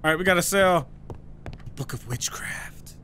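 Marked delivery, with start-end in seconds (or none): 1.8-3.0 slow, spooky-book whisper; hushed whisper. Acted. Seller naming the witchcraft book, whispering. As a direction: whisper it slowly, very softly.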